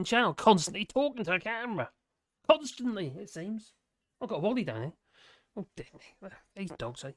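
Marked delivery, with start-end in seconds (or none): none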